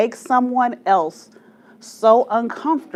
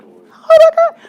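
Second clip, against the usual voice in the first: much higher, much louder panicked cry; meltdown shriek; 11 dB louder than usual. Retold or imitated. Girl seeing her doll hit by the fan, panicked shriek. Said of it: "So I'm having a meltdown".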